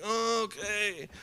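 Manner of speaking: high, soft, slow sleepy reply; just woken and drained; groggy reply. Retold or imitated. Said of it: "I was like".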